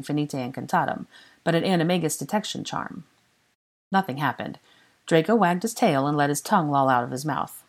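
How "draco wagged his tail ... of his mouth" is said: usual speaking voice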